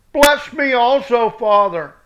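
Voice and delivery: high, loud pleading cry; bitter cry. Acted. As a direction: beg like a son robbed of everything. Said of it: "he cried with a cry great and bitter".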